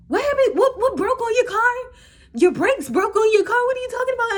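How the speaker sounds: high, loud, baffled squeal; mock confused shout. Boyfriend's confused reply imitated.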